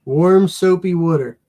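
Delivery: drawn-out Pennsylvania vowels in water; Pennsylvania twang. Real, not acted. Friend gives washing advice in Pennsylvania twang. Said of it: "You leave my Pennsylvania twang alone".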